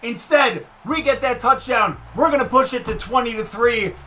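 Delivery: loud, high excited yelling; excited shout. Real, not acted. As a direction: shout it out in triumph. Yelling over a touchdown.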